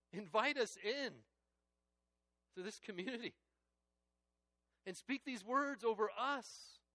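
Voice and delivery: loud, high, impassioned preaching; rising fervent sermon climax; full-force preaching. Real, not acted. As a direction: lift it up and proclaim each question.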